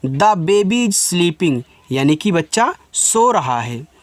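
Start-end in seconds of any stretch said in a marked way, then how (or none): 0.1-1.6 very slow, carefully pronounced English; slow model sentence. Shown as a demonstration. Hindi teacher models English slowly for students. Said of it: "आप बोल सकते हो".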